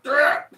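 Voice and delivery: short, high, loud mock scream; apoplectic yelp. Imitating someone exasperated by teasing, apoplectic yelp. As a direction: burst out in exasperation. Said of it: "almost getting apoplectic".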